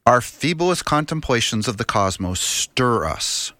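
normal talking voice

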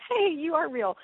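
wide-eyed wonder at meeting him; delighted surprise. Pretend. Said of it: "a little sense of".

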